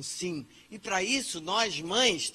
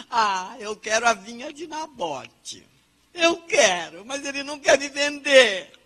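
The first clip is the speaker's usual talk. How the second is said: whiny Acabe; 5 semitones higher, 10 dB louder than usual. Acted. Acabe voiced whining for vineyard.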